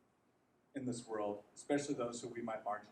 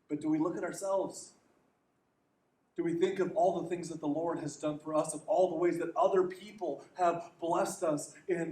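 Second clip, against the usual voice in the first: louder, driving pulpit voice; rising sermon intensity; impassioned preaching; 4 semitones higher, 6 dB louder than usual. Acted. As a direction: press the congregation with every question. Preaching build over blessings.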